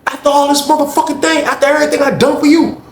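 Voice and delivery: retold angry tirade; betrayed rage. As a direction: tear into him for the betrayal. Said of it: "Slim was like".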